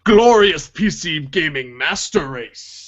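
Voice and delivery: slow grandiose mock proclamation; pompous elitist. Mocking PC elitist boast.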